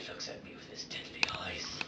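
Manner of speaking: soft, whispery suspense; tense hush. Acted. Batman facing the gunman's deadly eyes, tense whisper.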